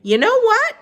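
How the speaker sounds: put-on high, far-off old woman voice; Gloria Dump voice. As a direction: pitch her up as an old lady. She said.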